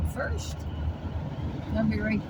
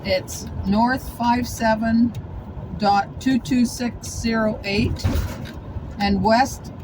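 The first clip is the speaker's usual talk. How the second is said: slow, careful number reading; slow and deliberate; slower than usual. Real, not acted. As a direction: read each digit out slowly. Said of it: "reading out coordinates".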